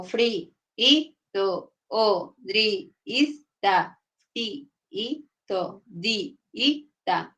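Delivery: slow syllable-by-syllable split; syllables pulled apart. Shown as a demonstration. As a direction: split each syllable with a pause. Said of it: "Necesito ese espacio para que se entienda".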